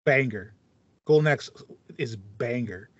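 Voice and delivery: ordinary delivery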